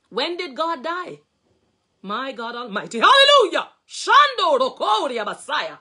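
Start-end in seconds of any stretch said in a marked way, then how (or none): 3.0-3.7 shouted high, full-throated praise; a preacher's sudden joyful cry; ecstatic hallelujah. Real, not acted. Ecstatic outburst mid-sermon. 3.9-5.8 loud ecstatic tongues; fervent praying in tongues; speaking in tongues. Real, not acted.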